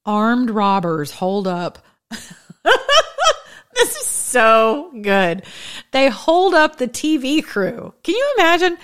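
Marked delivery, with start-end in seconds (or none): 2.1-3.4 high-pitched helpless laugh; real laugh bubbling over; burst of high laughter. Real, not acted. Reacting to the robbery headline, a real high laugh. 3.7-8.8 slow, words stretched by laughter; laughing through words. Real, not acted. Telling viewers the robbery story, laughing while speaking.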